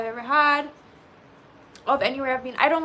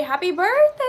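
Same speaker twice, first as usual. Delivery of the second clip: cheerful greeting; 5 semitones higher, 7 dB louder than usual. Real, not acted. Wishing chat happy birthday.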